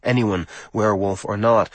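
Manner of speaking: usual speaking voice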